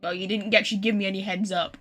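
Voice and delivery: ordinary delivery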